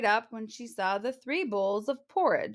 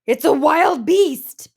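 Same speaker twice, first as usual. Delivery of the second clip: loud, slow mock growl; Papa Bear spotting Goldilocks; 6 semitones higher, 11 dB louder, slower than usual. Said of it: "Papa Bear growled".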